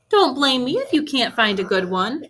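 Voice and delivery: ordinary talk, nothing marked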